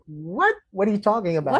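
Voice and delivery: imitated bafflement, pitch lifting; retold stunned disbelief. Homeowner stares at the firefighter offering to cook, retold stunned disbelief. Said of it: "She was just stunned. She just stared".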